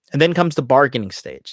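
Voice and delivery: usual speaking voice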